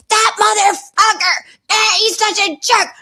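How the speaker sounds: high, drawn-out shrieky mock rage; hammed-up furious caller; imitated angry rant. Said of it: "And she's going off".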